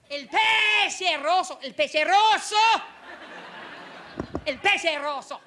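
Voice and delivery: tough-guy Italian; tough Italian delivery. Shown as a demonstration. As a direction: make nonsense Italian sound threatening. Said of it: "Check this out".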